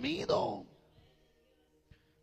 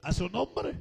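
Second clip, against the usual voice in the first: high, loud, slow preacher's shout; full-force preaching; 9 semitones higher, 9 dB louder, slower than usual. Real, not acted. Preacher urges the congregation to stay awake, shouted preaching. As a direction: thunder it out, drawing out the phrase ends.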